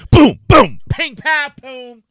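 shouted boom and pow noises; loud boom sound effects. Retold or imitated. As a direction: blast out the fight sounds. Imitating action sounds.

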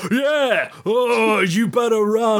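high, gleeful mock troll yelling at the fleeing victim; menacing troll taunt. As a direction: cackle it out like a bully chasing prey. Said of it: "he's like".

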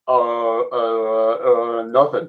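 lightly voiced slurring boxer, halting on drawn-out uhs; hint of a movie-boxer voice, hesitant and low-key; Sylvester Stallone. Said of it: "in your best Sylvester Stallone impression".

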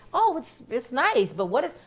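imitating mom, high and loud; loud, high-pitched mock bewilderment; puzzled mother. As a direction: pitch it way up as a baffled, complaining mom. Imitates mother puzzled by bleeped song.

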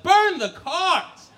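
slow, high, loud cry; horrified disbelief. Acted. Son hearing he must burn his cards, horrified disbelief. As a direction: drag the words out in horror.

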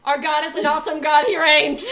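belting it out way too loud; shout-singing over the headphones. Real, not acted. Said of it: "so much louder".

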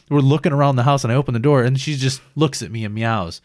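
ordinary talk, nothing marked

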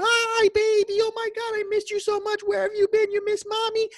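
very high, loud squealing greeting to a dog; gushing baby talk. Retold or imitated. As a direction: squeal like an owner greeting a puppy. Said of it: "you're screaming".

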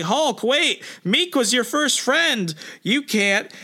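high, urgent appeal; Brood pleading. Retold or imitated. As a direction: stop him, pitched well up. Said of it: "he's like".

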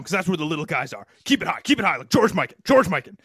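loud barking basketball coach; coach impression. Shown as a demonstration. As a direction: yell it like a coach on the sideline. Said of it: "I'll do my coach's impress-, impression".

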